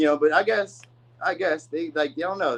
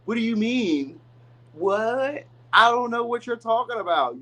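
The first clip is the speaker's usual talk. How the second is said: higher, playing dumb with a rising "What?"; feigned naive confusion; 6 semitones higher, slower than usual. Reader imitating a partner playing dumb, mock innocent. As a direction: act innocent, like you have no idea. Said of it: "just acts naive, like they don't understand".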